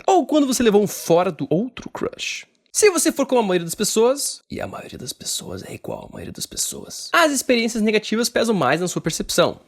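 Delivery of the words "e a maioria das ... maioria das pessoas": low whispered side comment; whispered aside. Acted.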